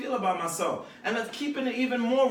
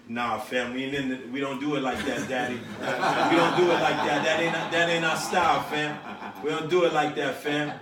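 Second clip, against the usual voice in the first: lightly voiced rapper, pitched a bit down; imitating reluctant rappers; 4 semitones lower than usual. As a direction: play a rapper brushing off fashion advice. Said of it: "You sound just like it".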